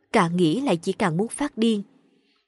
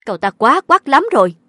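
raised, louder angry mother; loud indignation; 4 semitones higher, 8 dB louder than usual. A narrator's reading. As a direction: burst out loudly in indignation. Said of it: "đập mạnh tay xuống bàn lớn tiếng". (lớn tiếng phẫn nộ)

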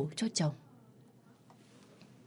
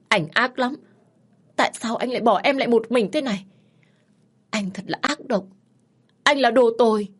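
raised-pitch, hurt accusation; wounded, grieving protest; anguished reproach; 5 semitones higher, 4 dB louder than usual. A narrator's reading. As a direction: reproach a lost husband, building to a loud outburst. Grieving widow reproaches dead husband.

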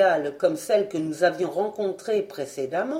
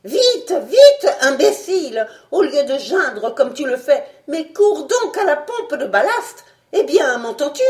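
loud, high, urgent shouting; furious shouted orders; harsh scolding; 6 semitones higher, 7 dB louder than usual. A narrator's reading. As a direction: berate him and drive him into action. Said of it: "il le rudoya".

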